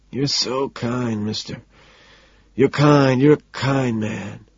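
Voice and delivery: slow, tearful gratitude; blubbering. A narrator's reading. Homeless man thanks his supposed helper, blubbering. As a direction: blubber it out, stretching the words. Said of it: "he blubbers".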